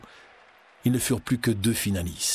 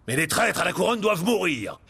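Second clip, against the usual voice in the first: ferocious; 4 semitones higher than usual. A narrator's reading. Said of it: "ajouta-t-il férocement".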